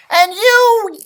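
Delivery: loud, high, slow furious scolding; tiny man giving a giant dog a piece of his mind; angry leprechaun. Acted. Leprechaun berating the dog for wrecking his home.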